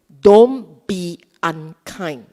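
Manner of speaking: slow, loud admonishment; loud, drawn-out warning to oneself; negative self-talk demo. Shown as a demonstration. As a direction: drag it out like a stern order. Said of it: "Don't do it like this".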